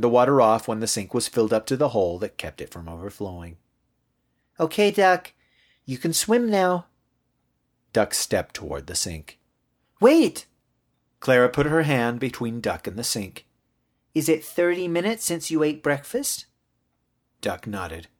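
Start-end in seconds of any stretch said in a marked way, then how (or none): 4.6-6.9 put-on high, childlike voice; high little-kid chirp; little girl. Girl talking to her toy. 10.0-10.5 put-on high, loud child's cry to stop; little kid halting a playmate; urgent little girl. Girl stops Duck. 14.1-16.5 put-on high child's voice, checking; high-pitched little-kid question; little girl. Girl questions her toy.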